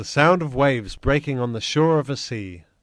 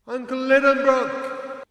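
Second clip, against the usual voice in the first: high, slow call into the dark; 12 semitones higher than usual. A narrator's reading. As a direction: call out for your uncle. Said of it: "I called out".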